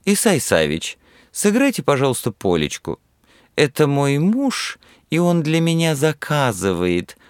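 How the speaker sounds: high, drawn-out spoiled whine; whiny spoiled pouty drawl. A narrator's reading. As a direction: coax it out like a spoiled girl. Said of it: "решила капризным голосом Люба".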